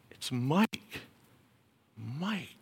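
gently calling a name, very soft. Acted. God quietly calling someone's name, very soft. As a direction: call the name like a quiet inner voice.

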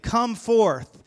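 high, loud call of command. Retold or imitated. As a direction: call it out as an order to rise. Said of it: "he demanded".

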